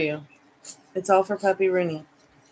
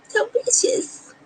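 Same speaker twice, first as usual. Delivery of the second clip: much higher, slow, stretched sing-song; sing-song repeat; slower than usual. Pretend. Owner repeats to the dog in slow high sing-song. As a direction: croon the phrase back in a silly high voice. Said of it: "It's so vicious when you wake up".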